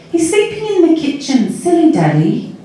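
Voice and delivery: put-on high-pitched little girl explaining; young child's voice. A narrator's reading. Little Chrissy explaining to Sally, young child's voice. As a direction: start very high, like a small child. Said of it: "the voice was really young".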